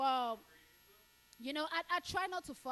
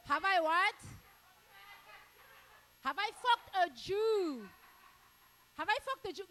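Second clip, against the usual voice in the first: incredulous; 6 semitones higher, 9 dB louder than usual. Acted. Repeating heckler's question incredulously.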